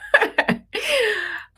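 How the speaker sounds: a burst of high laughter; high amused laugh. Real, not acted. Mother laughing at her own misspelling, high giggle.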